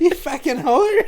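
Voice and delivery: mock outrage, laughing high. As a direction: shriek it through laughter.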